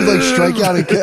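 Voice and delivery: usual speaking voice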